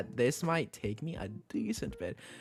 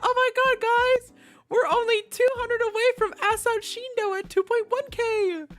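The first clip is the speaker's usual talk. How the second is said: very high, loud excited chatter; high loud thrilled talk; excited squealing; 5 dB louder than usual. Real, not acted. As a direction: gush to the chat in a squeal. Excited about milestone.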